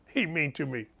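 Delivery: a quiet mock sulk through a laugh; whiny kid voice. Host doing his sulking voice for the audience, mock whine. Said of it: "that's the voice I go".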